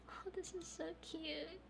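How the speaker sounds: high breathy cutesy coo. Real, not acted. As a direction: melt at something adorable. Coos at cute scene.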